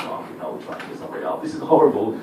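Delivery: whispered, mortified aside; horrified whisper. Retold or imitated. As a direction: whisper it to yourself in horror. Awkward bystander thinking.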